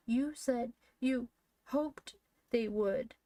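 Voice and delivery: high, slow, tearful accusation; smothered tones. A narrator's reading. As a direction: draw it out like a smothered wail. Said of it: "Joel's voice in smothered tones".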